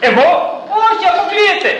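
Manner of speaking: high, quick, alarmed denial; Peter's panicked denial. Acted. Peter caught out by the servant girl, high frantic denial. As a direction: brush off the accusation in a flustered rush. Peter denies, acted quarrel.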